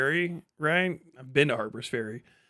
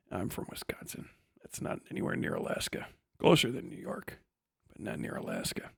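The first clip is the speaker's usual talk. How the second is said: much softer conspiratorial whisper; whispered aside; 8 semitones lower, 11 dB quieter than usual. Real, not acted. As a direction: whisper it as a secret to the listeners.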